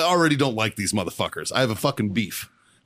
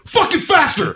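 loud, high-pitched drill yell; 6 dB louder than usual. Retold or imitated. Marine orders midshipman buttoning his jacket, yelling. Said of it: "I'm, like, yelling at this kid".

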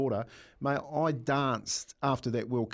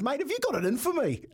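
high-pitched, laughing plea to the referee; mock whiny player; 13 semitones higher than usual. Ex-player imitating himself pleading with the referee, high and laughing. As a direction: squeak it out like a hard-done-by forward. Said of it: "Like this".

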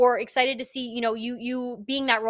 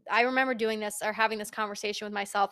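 ordinary delivery

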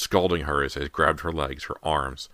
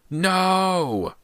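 high, loud scream of denial; horrified scream; 7 dB louder than usual. A narrator's reading. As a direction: scream it in horror. Horror at diary entry.

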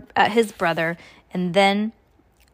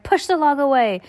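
high, urgent counter-order; cried out; 7 semitones higher, 4 dB louder than usual. A narrator's reading. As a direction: cry it out as a pressing command. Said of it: "Violet cried".

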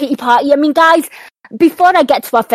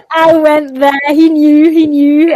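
loud, higher voice carried on real laughter; laughing; 4 semitones higher, 6 dB louder, slower than usual. Real, not acted. Host relishing her dig at the manager, laughing loudly. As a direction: crow it through laughter.

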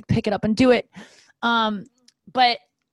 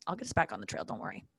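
said much softer, almost under the breath; quiet aside; 10 semitones lower, 12 dB quieter, faster than usual. Shown as a demonstration. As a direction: mutter the reassurance as a throwaway aside. Repeats promise as quiet aside.

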